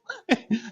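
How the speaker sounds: soft falling laugh; breathy laugh. Real, not acted. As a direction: let out a quiet laugh. Laughs at his own joke.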